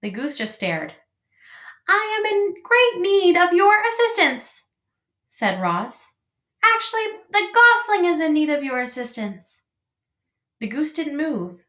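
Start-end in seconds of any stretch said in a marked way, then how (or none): 1.9-4.6 very high, cheery robot voice; over-friendly robot calling out. A narrator's reading. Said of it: "the robot's friendly voice boomed". 6.6-9.6 very high, cheery robot voice; over-friendly robot calling out. A narrator's reading. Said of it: "the robot's friendly voice boomed".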